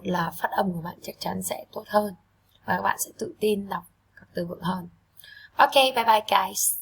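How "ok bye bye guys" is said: cheerful goodbye. Real, not acted. Teacher's cheerful farewell.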